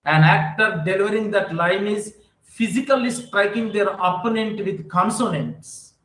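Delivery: ordinary talk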